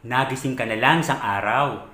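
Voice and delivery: usual speaking voice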